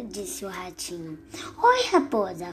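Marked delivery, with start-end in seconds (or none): none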